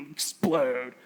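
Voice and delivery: low, mocking echo after a little snicker; smug scoff. Teenage son sitting by dad, smug low scoff. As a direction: imitate a smug teen sneering at the warning. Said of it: "I'm just sitting there smug as can be".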